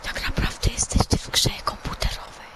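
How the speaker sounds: mysterious whispered voice; soft, voiceless whisper; Sterling. Pretend. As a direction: whisper like a hidden presence.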